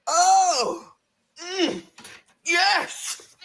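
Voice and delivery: high, drawn-out relish; hammed-up pleasure, high-pitched; swooning delight. Girlfriend relishing her man's outburst, swooning. Acts out being turned on.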